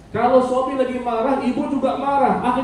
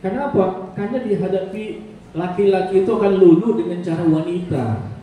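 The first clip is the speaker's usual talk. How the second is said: normal talking voice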